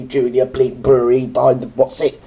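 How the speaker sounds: ordinary talk, nothing marked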